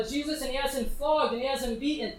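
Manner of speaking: ordinary delivery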